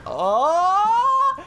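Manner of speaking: long, high-pitched "oh" of realisation; dawning realisation. Acted. Watchful viewer spotting the missing cushion, dawning realisation. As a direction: draw the oh out way up high.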